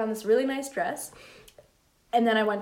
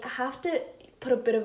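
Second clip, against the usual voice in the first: ordinary talk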